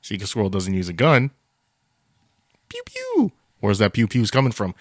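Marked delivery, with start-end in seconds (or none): 2.7-3.3 high-pitched mock laser pew pew; pew-pew sound effect. Pitching a cartoon title, high mock laser pews. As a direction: squeal out toy blaster noises.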